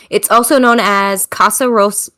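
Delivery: ordinary talk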